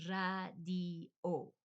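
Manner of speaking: radio in three separated syllables, R rolled; slow Spanish radio. Shown as a demonstration. Repeating slowly for learners, radio as RRAH-dee-oh. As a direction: spell out the sounds of radio, 'rrah, dee, oh'. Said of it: "same exact word in Spanish".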